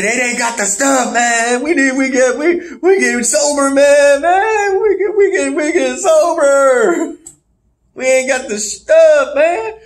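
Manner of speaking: hammed-up craving meltdown; mock panic. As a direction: whine desperately at a very high pitch. Acts out panic at being sober.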